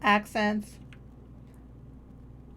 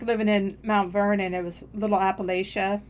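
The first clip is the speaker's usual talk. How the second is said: ordinary delivery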